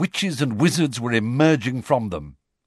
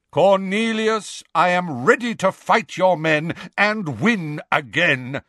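booming and higher; thunderous voice; 6 semitones higher than usual. Acted. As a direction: thunder the challenge. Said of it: "in a thunderous voice".

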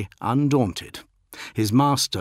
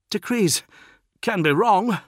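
much higher, insolent voice; defiant retort; 12 semitones higher, 5 dB louder than usual. A narrator's reading. Bloodied postulant disputes the decree, defiant. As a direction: contradict boldly in a raised pitch. Bloodied Marduk contradicts his captain.

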